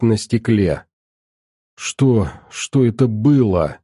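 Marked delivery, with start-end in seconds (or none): none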